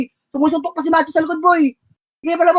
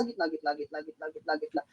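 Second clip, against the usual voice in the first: much lower and softer, drifting away; fading calls; 10 semitones lower, 17 dB quieter than usual. Real, not acted. Player repeating the call while turning away from the mic, fading. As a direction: let the repeated call fade out.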